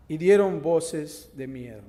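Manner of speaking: loud, rising Bible reading, building to alarm; dramatic scripture reading. Acted. Preacher reading the storm story to his congregation, loud and dramatic. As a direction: read the passage like it's happening now.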